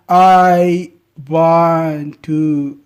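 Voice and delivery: imitating a stuck learner, words dragged very slowly; painfully slow, halting learner imitation; stuck, halting speech. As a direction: drag each word out as if searching for the next. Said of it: "They just stuck like that".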